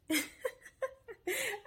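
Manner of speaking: high burst of laughter. Real, not acted. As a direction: let out a high, real laugh. Laughs after her first video podcast.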